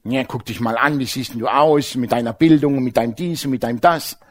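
low, hushed put-down voice; disparaging inner voices. Acted. Voices in his head belittling him, low dismissive mutter. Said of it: "dann hab ich Stimmen gehört".

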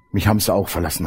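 the Lord, softly. Retold or imitated. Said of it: "hat der Herr gesagt".

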